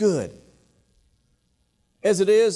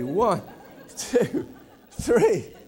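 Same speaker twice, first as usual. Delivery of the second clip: put-on counting the kids while breaking into laughter; mock mother's head count, laughing; much slower than usual. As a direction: giggle through the numbers.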